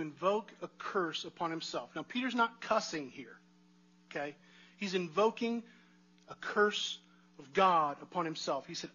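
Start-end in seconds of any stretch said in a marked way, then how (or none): none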